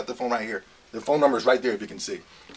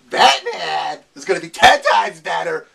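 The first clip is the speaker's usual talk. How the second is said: mocking quote; 3 semitones higher, 7 dB louder, slower than usual. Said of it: "when people be like".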